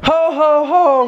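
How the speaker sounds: Santa ho ho ho. Acted. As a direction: laugh like Santa. Santa laugh.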